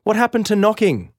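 high, loud protest; indignant yell. A narrator's reading. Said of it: "I yell".

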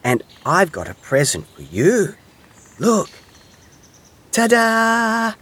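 high, excited little hedgehog, building to a drawn-out reveal; cheering. A narrator's reading. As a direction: present a surprise proudly. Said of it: "cheered Little Hedgehog".

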